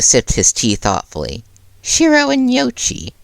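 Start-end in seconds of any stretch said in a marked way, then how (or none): 1.8-3.1 higher, lightly voiced puzzled principal; a hint of a small sly principal; Nezu's voice. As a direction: repeat the names with mild curiosity. Nezu repeating names, high far.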